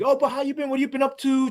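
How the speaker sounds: high mock friendly probing; prying phony guy. Coworker fishes for information, high phony friendliness. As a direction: imitate a snoop fishing for info, pitched high.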